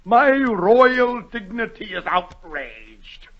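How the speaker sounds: a king affronted in his dignity; pompous outraged king; royal outrage. Acted. King's outburst.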